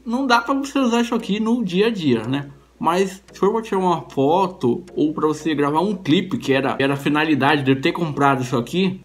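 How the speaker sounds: teeth covered by grill; slightly awkward articulation; speaking with grillz. Real, not acted. Seen 3: silver grillz visible on upper and lower teeth throughout.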